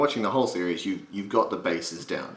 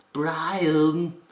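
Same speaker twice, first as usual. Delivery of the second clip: high, slow, less British cartoon child; higher, softened-accent character whine; Stewie Griffin; 8 semitones higher, much slower than usual. Shown as a demonstration. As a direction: draw it out high, lightly British. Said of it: "cheerful, I guess, a little less British and a little high-pitched".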